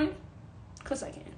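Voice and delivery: whispered cheeky answer to self; whispered aside. Real, not acted. Answering her own question in a sly whisper. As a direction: breathe it out like a private joke.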